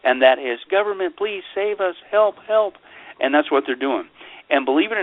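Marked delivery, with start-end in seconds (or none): 0.7-2.8 high-pitched pretend pleading; mock cry for help. Mocking the public begging government for protection, mock helpless cry. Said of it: "cry out for what they want us".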